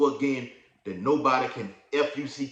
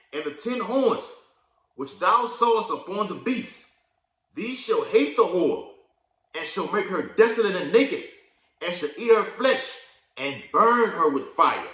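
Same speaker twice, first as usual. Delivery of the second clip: loud, raised scripture reading; preaching scripture; 7 semitones higher, 6 dB louder than usual. Acted. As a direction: proclaim the verse to the congregation. Reads scripture aloud, preaching.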